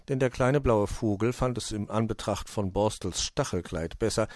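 ordinary delivery, nothing marked

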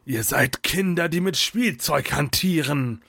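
dark mage's taunting voice; scornful villain. A narrator's reading. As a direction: taunt them as the evil sorcerer.

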